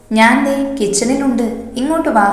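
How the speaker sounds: usual speaking voice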